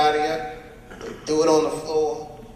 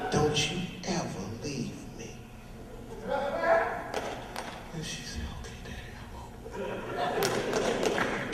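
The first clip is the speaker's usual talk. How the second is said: quiet threat; 2 semitones higher, 9 dB quieter, slower than usual. Acted. Iceberg's quiet threat, her whispered reply.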